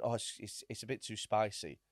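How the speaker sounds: soft, hesitant apology; timid quiet. Retold or imitated. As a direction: mumble it meekly. Meek complaint to server.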